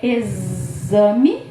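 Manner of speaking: "exame" with a long drawn-out z sound. Shown as a demonstration. Teacher shows X's sounds to a child, z held long. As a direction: stretch the buzzing z in "exame". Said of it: "mostro pra criança o X".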